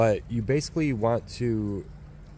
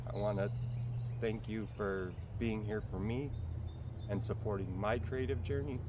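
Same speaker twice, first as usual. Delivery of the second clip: teary; 10 dB quieter than usual. Real, not acted. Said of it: "I'm getting a little teary here".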